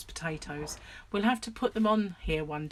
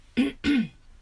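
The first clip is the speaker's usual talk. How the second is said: loud harsh throat clearing; 8 semitones higher, 8 dB louder than usual. Real, not acted. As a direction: clear your throat hard. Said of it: "sorry about the throat clearing".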